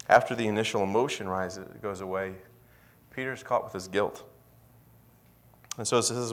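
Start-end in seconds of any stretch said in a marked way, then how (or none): none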